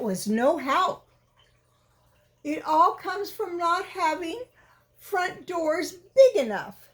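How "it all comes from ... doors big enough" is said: higher, mildly huffy bear voice; lightly defensive Pooh. Acted. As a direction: answer back a bit indignantly. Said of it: "said Pooh".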